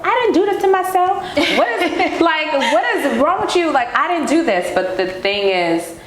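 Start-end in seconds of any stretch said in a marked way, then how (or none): 0.1-2.2 much higher and faster, indignant disbelief; retold exasperated outburst. Retelling her old anger to the host, high fast indignant outburst. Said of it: "'cause I was so pissed". 2.2-4.7 much higher and faster, indignant disbelief; retold exasperated outburst. Retelling her old anger to the host, high fast indignant outburst. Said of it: "'cause I was so pissed".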